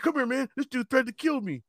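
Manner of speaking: high, agitated tattling; mock a scared guy calling his friend; imitating a rattled man; panicked complaint. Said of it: "He said".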